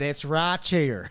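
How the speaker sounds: slow, loud Southern drawl; imitated Southern drawl. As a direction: stretch it out in a mock Southern accent. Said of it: "a strong Southern drawl".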